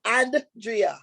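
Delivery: slow, deliberate spelling of Andrea. Shown as a demonstration. Andrea spelling out her name slowly to the host. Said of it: "Listen here".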